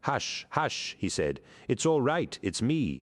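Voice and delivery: high, gentle soothing; calm a terrified woman tenderly; reassuring hush. A narrator's reading. Grammaticus soothing frightened Ruxana in the dark room, gentle and high. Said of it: "holding out his hands reassuringly".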